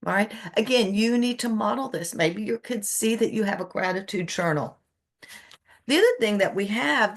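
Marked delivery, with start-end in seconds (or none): none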